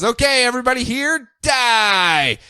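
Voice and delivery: high commanding villain order. Retold or imitated. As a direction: order them to die like a god. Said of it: "her godly power of a voice of command".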